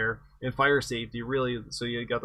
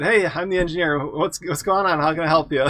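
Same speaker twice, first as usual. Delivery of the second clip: cheerful; 4 semitones higher, 7 dB louder than usual. Retold or imitated. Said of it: "With a big smile on my face".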